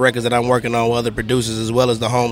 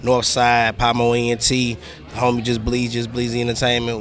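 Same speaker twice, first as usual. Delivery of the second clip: ordinary delivery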